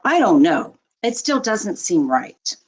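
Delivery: ordinary delivery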